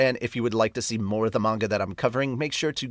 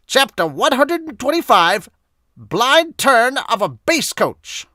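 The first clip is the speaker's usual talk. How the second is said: announcer; 10 semitones higher, 8 dB louder than usual. Acted. Dramatic manga narration read-out.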